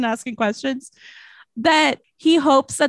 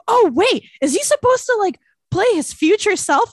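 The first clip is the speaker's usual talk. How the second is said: surprised; 11 semitones higher, 4 dB louder than usual. Retold or imitated. Realizing the movie's twist.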